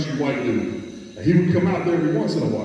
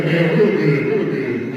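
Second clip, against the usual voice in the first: louder, laughing brag; 2 semitones higher, 4 dB louder, slower than usual. Real, not acted. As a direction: chuckle through it. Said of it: "good translator".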